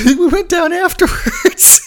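high, slow words bursting with laughter; laughing punchline. Real, not acted. Quoting Snook's boast to listeners, cracking up laughing. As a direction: lose it laughing as you say it.

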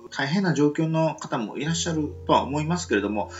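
ordinary delivery

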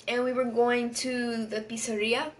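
slips into a Spanish accent on pizzeria, pisəɹia with a trilled feel; light Spanish accent, pizzeria said as pee-tseh-REE-ah. Real, not acted. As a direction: says pizzeria the Spanish way without meaning to. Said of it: "In a Spanish accent".

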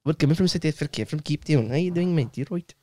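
put-on Cape Town greeting; mock South African; South African accent. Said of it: "South African".

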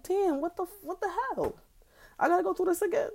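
high, squeaky mock outrage at her own body; exasperated disbelief. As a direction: throw it up high like you can't believe this again. Her own exasperated reaction quoted.

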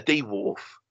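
"D wharf" as two separate words; wife's mispronunciation. Retold or imitated. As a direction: break "D wharf" into dee and wharf. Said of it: "That's how she says it".

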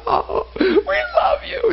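high, slow, wailing "we love you"; mock crying praise. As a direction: ham up a weeping worshipper. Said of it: "and they're crying".

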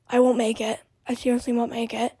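normal talking voice

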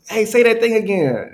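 high, excited plea to hear it again; begging for another go, thrilled; eager request. Retold or imitated. Villagers ask to hear English.

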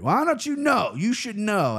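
high-pitched, incredulous badgering; mock indignation. Drunk guy challenging English buddy over Indy five hundred, mock indignant. Retold argument with drunk stranger.

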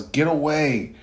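put-on angry boy yelling at a villain. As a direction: shout at him to get away from the body. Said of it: "And Naruto yells".

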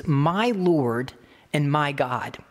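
"My Lord and my God." drawn out very slowly; slow solemn confession. Acted. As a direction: give each word of "My Lord and my God." its own weight. Thomas's confession, slowed right down.

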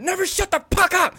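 high, loud drill-instructor outburst; acted scream, fast then piercing; mock furious yell. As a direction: blow up mid bedtime story, imitating the instructor. Instructor's bedtime story turns into yelling.

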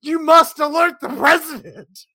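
high, loud mock alarm; mock alarmed poster. Imitating a reply guy's frantic warning, high and loud. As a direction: shout it like an urgent warning. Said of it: "And he's like".

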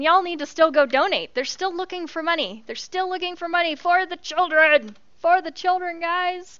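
pleading upbeat appeal; rally them to donate; high excited plea. Real, not acted. Urging donations.

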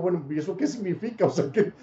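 pregunta entre risas, incrédulo; riéndose incrédulo. Retold or imitated. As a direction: se ríe mientras cuestiona. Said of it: "y yo me río y digo". (pregunta riéndose, sin creérselo)

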